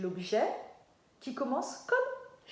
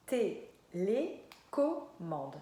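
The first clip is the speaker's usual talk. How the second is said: TÉLÉCOMMANDE cut into TÉ - LÉ - CO - MMANDE; very slowly, a beat on each syllable of TÉLÉCOMMANDE; 2 semitones lower, much slower than usual. Shown as a demonstration. Teacher naming the object for children, syllable by syllable. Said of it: "Quelle est la première syllabe?".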